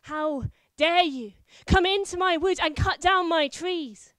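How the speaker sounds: loud, commanding threat; wife disguised as a knight threatening her husband; terrifying voice. Acted. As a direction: roar it down at the cowering knight. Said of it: "in a terrifying voice".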